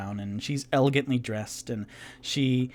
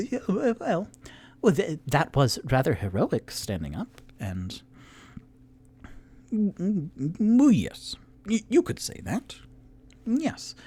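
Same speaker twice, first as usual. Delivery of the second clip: high, prissy, trailing into a whisper; a stuffy intellectual conceding grudgingly; fussy Rock voice; 5 semitones higher than usual. Acted. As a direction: Rock answering the tiefling, high-pitched and hemming. Rock's high fussy reply.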